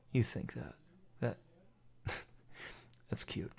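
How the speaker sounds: soft, whispered taunt with a little laugh; hushed mocking menace. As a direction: taunt quietly under the breath. Menacing reveal acted in bit.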